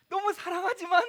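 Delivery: laughing husband heading to bed; laughing mock refusal. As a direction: ham up a sleepy brush-off. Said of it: "막 이렇게 하면서".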